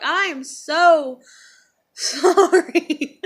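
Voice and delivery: high, loud apology broken up by laughter; teary laughing apology. Retold or imitated. Teller apologizing to boy after pushing him, laughing tearfully. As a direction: stretch out sorry through a tearful laugh. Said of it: "got teary-eyed and cried".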